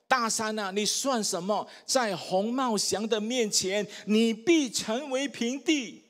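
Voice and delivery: slow, emphatic proclamation; demonstrated declaration. Shown as a demonstration. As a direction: show the church how to proclaim it. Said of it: "我示范给大家听一次哈".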